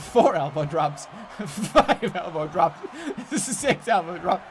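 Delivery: slow counting through laughter; slow count broken by laughter; laughing count. Real, not acted. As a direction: giggle through each count. Counting elbow drops, laughing.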